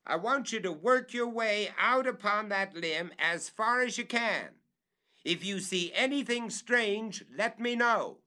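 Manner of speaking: excited master calling up; much excited. Acted. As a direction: urge him out along the limb. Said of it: "evidently much excited".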